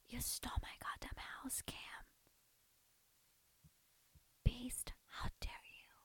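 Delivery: hushed dramatic whisper; whispered quote. Acted. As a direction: whisper the famous line.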